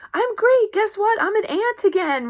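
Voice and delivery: very high, loud burst of joy; thrilled. Retold or imitated. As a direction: squeal the good news. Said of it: "Or you might say".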